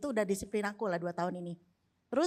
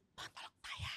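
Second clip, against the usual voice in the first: whispered silent prayer, much softer; inner whisper; 17 dB quieter than usual. Retold or imitated. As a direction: breathe it as a prayer said in your head. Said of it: "ngomong dalam hati".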